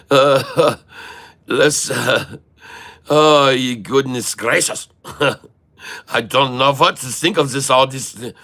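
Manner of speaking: hammed-up German accent for stock chat. Streamer ranting about SoFi buyers, in mock German accent. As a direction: keep a thick comic German accent throughout. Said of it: "No, this is my German accent".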